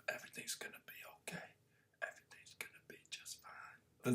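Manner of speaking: soft reassuring whisper. Pretend. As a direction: whisper it gently, like soothing someone. Comforting whisper to self.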